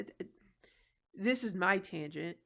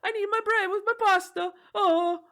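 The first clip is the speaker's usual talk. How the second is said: loud, high mock Italian wail; 9 semitones higher, 8 dB louder than usual. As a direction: ham up a cartoon Italian, loud. Mocking Italians, big voice.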